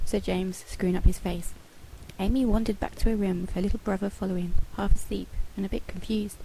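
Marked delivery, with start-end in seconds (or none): none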